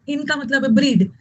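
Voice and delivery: normal talking voice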